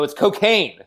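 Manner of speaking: high, loud incredulous correction; squeaky indignant protest; incredulous insistence. Retold or imitated. Insisting to cops, retold.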